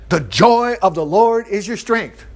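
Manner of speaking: ordinary delivery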